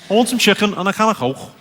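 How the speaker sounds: broad Liverpool patter; Scouse accent. Shown as a demonstration. Comic saying something authentically Scouse, hammed accent. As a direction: carry thick Scouse through each phrase. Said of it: "authentically Scouse".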